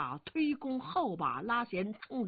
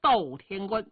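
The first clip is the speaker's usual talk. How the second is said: calling out; 6 dB louder than usual. A narrator's reading. Wang Mang summons the minister Dou Rong, calling out. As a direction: summon an official by title. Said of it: "就叫了一声".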